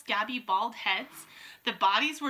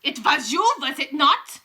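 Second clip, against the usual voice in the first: accusing witch; 4 semitones higher, 8 dB louder than usual. A narrator's reading.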